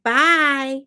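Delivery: high, loud, drawn-out sassy goodbye; sassy toddler. Retold or imitated. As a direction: imitate a sassy little girl leaving. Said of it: "She's so sassy".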